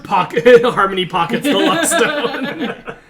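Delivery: words through laughter; giggling while talking; laughing. Real, not acted. Table jokes about unlucky member.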